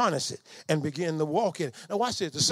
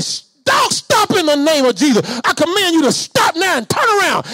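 piercing high rebuke, much louder; commanding shout; 9 semitones higher, 13 dB louder than usual. Retold or imitated. Father shielding his son from a Doberman, commanding shout. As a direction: stop the dog with full spiritual authority.

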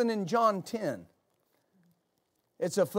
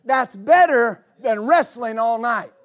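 raised, ringing preacher's voice; fiery preaching; 4 semitones higher, 4 dB louder than usual. Real, not acted. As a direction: preach it out with full force. Preaching at full force.

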